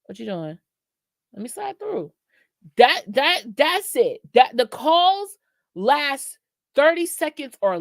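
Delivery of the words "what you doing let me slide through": high, soft, flirty late-night call; late-night booty call. Retold or imitated. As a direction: slide into the call, coaxing and much higher.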